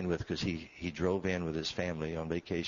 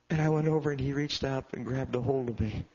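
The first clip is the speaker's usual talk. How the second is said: preaching; 7 semitones higher, 6 dB louder than usual. Acted. Preacher building the story for the church, loud. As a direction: build toward the climax.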